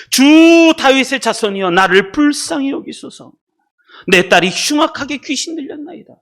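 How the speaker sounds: high, loud, drawn-out acted plea; crying out. Preacher as desperate mother begging the Lord for mercy, crying out. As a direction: cry out to be heard, stretching the opening words. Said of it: "소리를 지르기 시작합니다".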